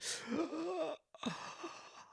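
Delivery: long high silent gasp; mock crying gasp. Kid gasping after mama's slap. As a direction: gasp in before the big cry. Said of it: "right after the mute".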